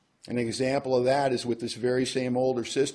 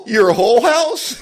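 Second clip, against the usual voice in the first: much higher and louder, slow, laughing; stunned disbelief; 12 semitones higher, 13 dB louder, slower than usual. Retold or imitated. Brother stunned by sister's request, high and laughing. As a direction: squeak it out in shock.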